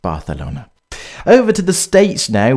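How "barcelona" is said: quiet, lower Barcelona said Barthelona; Spanish Barcelona. Shown as a demonstration. Insisting to co-host, quiet Castilian-th Barcelona. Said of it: "not just putting on an accent".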